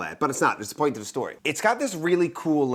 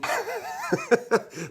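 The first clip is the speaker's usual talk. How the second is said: laugh; 7 semitones higher, 4 dB quieter than usual. Real, not acted. Laughs at own joke.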